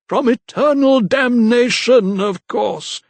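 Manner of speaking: very slow, high, loud proclamation; zealous vicar. A narrator's reading. Vicar declaring damnation to the lady, slow and grand. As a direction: intone damnation with relish.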